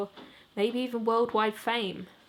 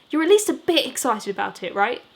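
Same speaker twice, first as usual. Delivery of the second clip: eager, loud, higher; grinning; 4 semitones higher, 7 dB louder than usual. Acted. Lister nudging Jimmy before the show, grinning and loud. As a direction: push for a yes with a big grin.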